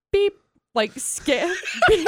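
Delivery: high mock scanner beep. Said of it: "that's how I read it".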